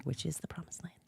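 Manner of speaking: soft joking whisper; whispered aside. Real, not acted. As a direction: whisper it as a sly joke. Joking aside about Pittsburgh.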